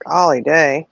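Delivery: usual speaking voice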